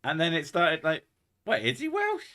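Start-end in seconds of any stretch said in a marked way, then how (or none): 1.4-2.2 confused surprise. Retold or imitated. Acted confusion quote.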